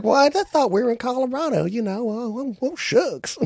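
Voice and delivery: high, airy mock ditzy voice; ditzy woman impression. Woman caught at customs, ditzy high mock voice. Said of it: "She's like".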